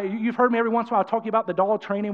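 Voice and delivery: normal talking voice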